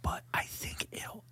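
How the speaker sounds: soft ASMR whisper. Real, not acted. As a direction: whisper close to the mic.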